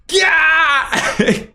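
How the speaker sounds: high, drawn-out comic yell; long high-pitched mock scream; mock exasperated yell. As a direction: stretch out a high silly "gaah". Mock outburst over their hair.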